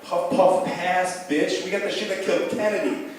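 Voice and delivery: hammed-up old dude bragging; cool stoner grandpa. Cool grandpa passing the joint, swaggering and loud. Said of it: "grandpa that's like".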